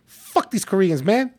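mock outburst with a hint of Chinese accent; Chinese-American accent. Friend retells a Chinese dinner companion's gripe, imitated accent. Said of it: "but he has the accent?".

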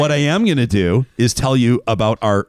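upbeat sponsor read, words leaned on. Acted. Host reading sponsor spot to listeners, upbeat ad read. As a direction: sell it like a radio spot. Reads sponsor ad over music.

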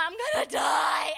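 a hammed-up wail of panic; freaking out. Millennial passenger on the sinking boat, mock freaking out. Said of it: "and I'm freaking out".

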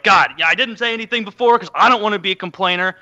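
high, loud mock complaining; whiny excuse-making. Host after losing, complaining in a mock whine. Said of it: "excuse after excuse".

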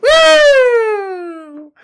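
a loud cheering woo after the laugh; whoop. Real, not acted. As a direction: let out a loud gleeful whoop. Whooping after joking rant.

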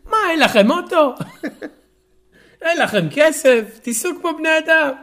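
mock French snob in Hebrew; French accent in Hebrew. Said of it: "תגיד את זה במבטא צרפתי".

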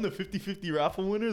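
normal talking voice

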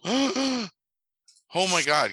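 put-on a kid's breathless "oh my God", pitched high up; the young fan realising who is calling, thrilled; starstruck gasp. Kid answering phone hears Mork, starstruck excited gasp.

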